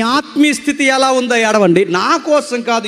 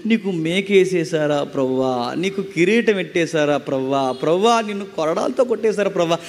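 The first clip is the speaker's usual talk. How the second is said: mock sentimental lament; 5 semitones lower, 4 dB quieter than usual. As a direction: mimic a melodramatic mourner, wailing softly and low. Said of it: "ఇలా యాక్టర్లాగా యేసుక్రీస్తుని ఊహించుకుని ఏడవద్దు". (ఏడుపును అనుకరిస్తూ దీనంగా)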